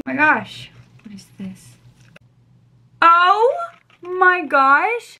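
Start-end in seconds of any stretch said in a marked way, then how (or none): 1.0-1.8 hushed, voiceless whisper; whispered wonder. Real, not acted. Whispers while unwrapping a gift. 3.0-5.2 high, loud drawn-out gasp of delight; be blown away by a gift; real amazement. Real, not acted. Sees gifted earrings.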